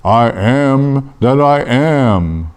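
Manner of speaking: very slow, solemn divine pronouncement; voice from the bush. Acted. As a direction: draw out every word like God declaring a name. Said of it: "And the voice said".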